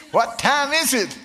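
shouted call. Acted. Preacher shouting question to crowd.

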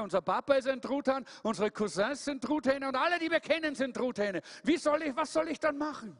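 hoch und hilflos aufzählend; ratloser Truthahn. Acted. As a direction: verzweifelt um Rat fragen. (Tom fragt die Eule hoch und ratlos)

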